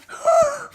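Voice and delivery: high, quick gasp of surprise; delighted gasp. Real, not acted. Child hears George finally bark, high amazed gasp.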